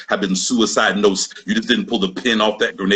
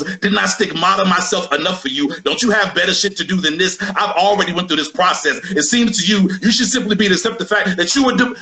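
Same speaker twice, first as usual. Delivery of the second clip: higher, fed-up voice; exasperated Jesus voice; 9 semitones higher than usual. Pretend. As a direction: sigh it out like a weary saviour. Voicing an irritated Jesus.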